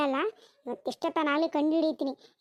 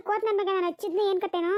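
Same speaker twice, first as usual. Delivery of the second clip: normal talking voice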